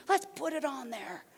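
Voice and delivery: high-pitched eager parent voice; high, pretend-excited encouragement; playful enthusiasm. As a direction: cheer the idea on, hammed up. Acts out parent cheering wild idea.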